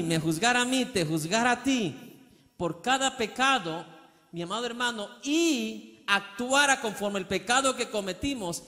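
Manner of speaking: high, rising preacher's fervour; pleading with the congregation, fervent preaching. Acted. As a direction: preach it with mounting passion. Impassioned preaching.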